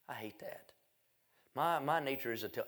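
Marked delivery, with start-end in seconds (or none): 0.1-0.7 a real whispered aside. Real, not acted. Preacher to the congregation, whispering it. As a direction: breathe it out without voice. Confessing he hid the news.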